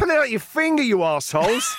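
high-pitched hammed-up rant; mock scolding. Host berating listeners who haven't reviewed, mock-angry. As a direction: tell them off in comic exasperation.

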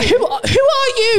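high, loud laughing yell; laughing shout. Real, not acted.

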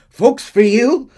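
high, loud, haughty tycoon voice; loud and high, sneering at the union man; pompous Cornwall. Retold or imitated. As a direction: scoff at him like an outraged rich man. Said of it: "Cornwall cuts him up".

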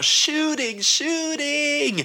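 high, frantic yell of a fleeing crowd; panicked screaming. Retold or imitated. Fleeing crowd screams a warning in a high panicked yell. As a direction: scream it like running for your life. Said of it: "bara skriker".

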